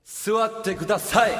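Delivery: slow cool command; a bit irritated and cool; cool idol voice. Pretend. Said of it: "ちょっとかっこよすぎる。".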